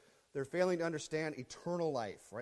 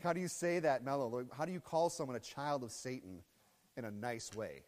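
normal talking voice

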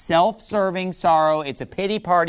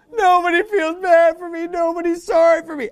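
high-pitched mock crybaby whine; self-pitying; 11 semitones higher, 3 dB louder than usual. Saul feeling sorry for himself publicly, mock self-pitying whine. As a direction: whine it as if badly fake-crying. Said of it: "just feeling sorry for himself".

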